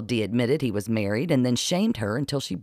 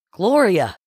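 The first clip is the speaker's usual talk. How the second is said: sharp loud bark, pitched up; barked name; 8 semitones higher, 7 dB louder than usual. A narrator's reading. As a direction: snap her name out to wake her. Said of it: "Dee barked".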